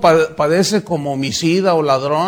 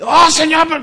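much higher and louder whine; mock protest; 11 semitones higher, 8 dB louder than usual. Pastor protesting to God, loud high whine. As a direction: protest like a sulky child.

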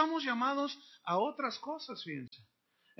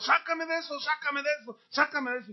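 mock pleading, higher and repetitive; ruego insistente; 5 semitones higher than usual. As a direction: mimic someone begging God for rescue. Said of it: "echarle la bolita al Señor". (súplica insistente, burlona y aguda)

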